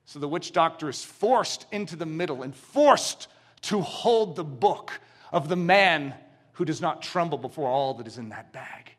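ordinary talk

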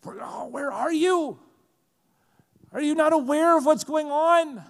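high, rising cry to God; acted anguished calling-out, pitched high; desperate plea.

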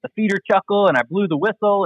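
high-pitched excited retelling; retold elation. Novice hunter telling guide about his success, retold elation. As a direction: imitate a thrilled beginner, pitched well up. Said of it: "he felt great".

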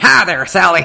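big, booming Texan greeting; Texan accent. Retold or imitated. Huge Texan customer hailing his waitress, booming Texan accent. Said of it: "He was a Texan. He went".

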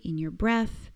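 ordinary talk

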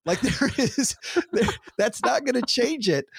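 real giggles breaking into the words; laughing while talking. Real, not acted. Commenting on the sketch's joke, still laughing.

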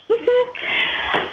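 high, airy laugh at her own bad luck; ironic laugh. Real, not acted. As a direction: laugh it off in disbelief.

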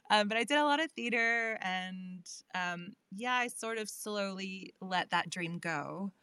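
ordinary talk, nothing marked

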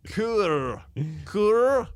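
repeated Scottish tryouts climbing in pitch; over-rolled amateur Scottish accent. Shown as a demonstration. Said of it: "how do you say core in a Scottish accent".

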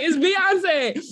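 high, disbelieving cry of the name; incredulous exclaim. Retold or imitated. Speaker scolding the crowd for not dancing, high incredulous cry.

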